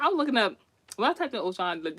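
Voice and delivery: usual speaking voice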